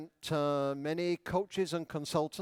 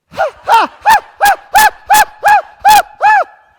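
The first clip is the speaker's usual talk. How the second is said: very high, loud "ha" bursts; high exercise laugh; 24 dB louder than usual. Shown as a demonstration. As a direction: force out rhythmic laughs in a high squeal. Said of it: "laugh a little higher".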